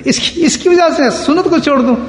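acting out an indignant refusal; retold indignant cry. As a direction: protest in a high, outraged voice. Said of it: "انہوں نے زور سے چیخا".

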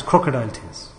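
low, soft mocking repeat of crocodile tears. Shown as a demonstration. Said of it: "Don't release fake tears".

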